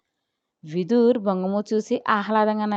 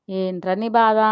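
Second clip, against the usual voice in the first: plain reading voice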